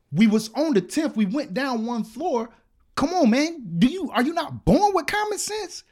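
loud, high, furious rant; losing it. Retold or imitated. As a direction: shout him down, pitch climbing as you go. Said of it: "I lost it".

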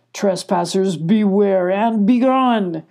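slow, loud, drawn-out command; demanding hidden voice. A narrator's reading. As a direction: order the cats away from the bushes. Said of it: "demanded the voice".

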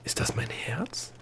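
slow, hushed whisper; whispered question. A narrator's reading. Girl asking the gnome about the distant pounding, whispered. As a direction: whisper it slowly, wondering.

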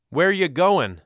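put-on loud, high-pitched boy's call; calling after someone. A narrator's reading. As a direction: shout it after your brother as he runs off. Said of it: "my brother called after me".

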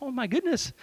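high-pitched, mildly awestruck retelling; amazed. Retold or imitated. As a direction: recall being amazed, pitch lifted. Retold amazed reaction to the moment.

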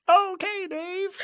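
very high helium squeak; squeaky chipmunk voice. Pretend. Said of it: "You should have just been like".

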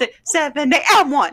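high counting of the steps; dance count. Pretend. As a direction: call the beats for the class. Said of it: "I feel like Debbie Allen".

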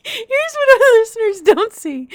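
high, loud, laughing through the words; real laughter. Real, not acted. Cohost cracking up while letting listeners in, laughing. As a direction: barely get it out for laughing. Cracking up over caller's carol.

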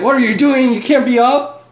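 high, alarmed nurse voice, mock panic; nurse freaking out. Hospital nurse panics at patient out of bed, high alarmed. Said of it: "the nurse started freaking out".

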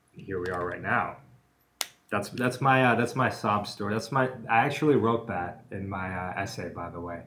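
ordinary talk

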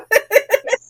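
high, loud burst of laughter. Real, not acted. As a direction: laugh at her own idea. Laughing at spin-off idea.

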